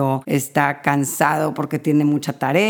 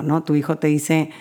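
ordinary talk, nothing marked

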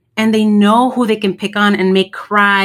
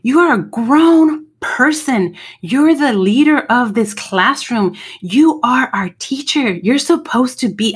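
higher, slow, pointed scolding of a teacher; retold outburst at teacher; 3 semitones higher, slower than usual. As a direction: slam the desk and lay into her, word by word. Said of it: "I went off on her".

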